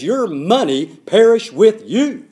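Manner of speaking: slow, loud, furious curse; incensed Peter. Acted. Peter damns Simon's money, loud and slow. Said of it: "The Apostle Peter is incensed".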